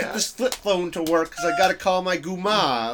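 a bad copy of a New York Italian guy on the phone; Jon Stewart's Italian. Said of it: "an impression of Jon Stewart doing an Italian".

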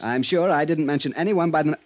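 normal talking voice

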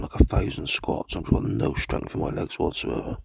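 normal talking voice

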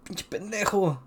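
lightly put-on Spanish dubbing voice; imitated dubbing voice. Podcast host quoting the film's Spanish dub, lightly imitated dubbing voice. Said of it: "ya sabes cómo es el doblaje".

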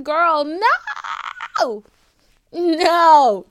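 drawn-out, high, loud no; loud mock refusal. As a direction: wail a refusal at the therapist, hammed-up. Acted refusal to therapist's suggestion.

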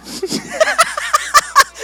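squealing laugh out of control; high laughing fit. Real, not acted. Losing it on stage.

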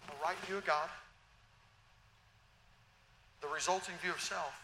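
normal talking voice

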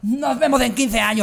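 grito agudo y fuerte; despedida de anime. Acted. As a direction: amenaza dramática de otaku que huye corriendo. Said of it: "se fue corriendo como Naruto". (grito exagerado a lo Naruto)

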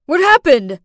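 loud, urgent cry of alarm; loud frantic question. Acted. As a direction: demand to know what happened, panicked. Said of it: "Ethan frantically asked".

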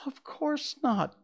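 put-on high, slow girlish answer; little girl's voice. A narrator's reading. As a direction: answer innocently as a startled girl. Dorothy answers the melting witch.

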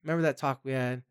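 put-on a parent gently asking a teen to clean their room; gentle request. Shown as a demonstration. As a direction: coax them kindly, like talking to a friend. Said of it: "I'm just gonna say".

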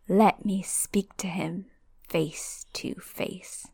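slow eerie whisper fading out; the hidden master whispering his demand. Acted. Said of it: "the high voice speaks again".

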